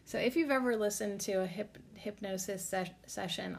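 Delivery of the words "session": ordinary talk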